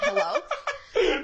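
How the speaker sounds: half-laughing disbelief; incredulous hello. Retold or imitated. Incredulous retold reaction.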